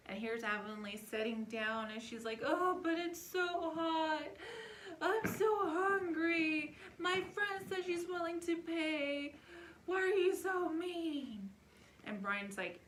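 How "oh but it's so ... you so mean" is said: put-on high, loud, slow weepy child whine; crying whiny kid. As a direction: wail it like a hot, hungry little girl near tears. Daughter crying, whining at dad.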